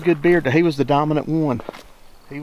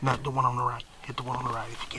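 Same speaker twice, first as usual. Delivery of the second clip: urgent hushed whisper; hunting whisper; 7 dB quieter, faster than usual. Real, not acted. Dad whispering directions to his son. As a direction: whisper the correction quickly. Hunters whispering in turkey blind.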